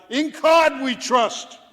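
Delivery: high, slow mock shout; hammed-up skipper yell. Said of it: "our skipper would yell".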